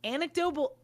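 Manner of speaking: usual speaking voice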